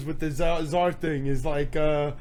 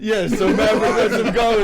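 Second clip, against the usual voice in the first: normal talking voice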